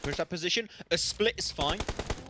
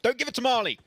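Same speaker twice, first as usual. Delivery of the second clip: raised, urgent warning; loud warning; 8 semitones higher, 5 dB louder than usual. Real, not acted. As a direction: call it out loud. Shouted warning to co-caster.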